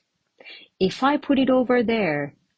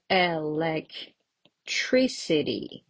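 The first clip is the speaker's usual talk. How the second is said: slow, segmented teacher's model; sounded out slowly; 2 semitones lower, 4 dB quieter, slower than usual. Shown as a demonstration. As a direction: break the word into slow chunks. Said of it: "How many syllables are there?".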